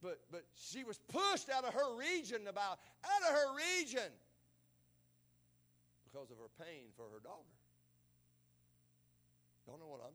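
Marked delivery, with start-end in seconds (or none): 0.0-4.1 loud, high, fervent sermon voice; full-force preaching. Acted. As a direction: preach it hard to the congregation.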